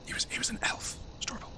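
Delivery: hushed prompt; whispering. Real, not acted. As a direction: whisper the correction aside. GM whispers correction to storyteller.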